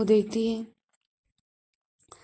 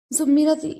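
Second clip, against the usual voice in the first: plain reading voice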